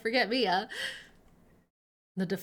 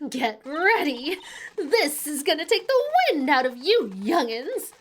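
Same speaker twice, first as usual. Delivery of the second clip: put-on loud, high, feisty old witness; big, emphatic old-lady rant; excitable old lady; 6 semitones higher, 9 dB louder than usual. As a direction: holler it like a scrappy old busybody.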